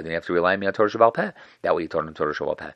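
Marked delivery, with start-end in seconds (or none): 0.0-1.3 fast, louder gotcha; quick rebuttal. Retold or imitated. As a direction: snap the point home quickly. Hillel turns the argument.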